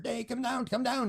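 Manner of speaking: high calling voice urging her down. Retold or imitated. Man calling his daughter outside to come see.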